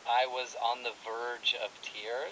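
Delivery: slow, careful read of the phrase; slow model phrase. Shown as a demonstration. Teacher offering a fancier phrase, said slowly. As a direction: spell it out slowly for learners. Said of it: "Or you could say".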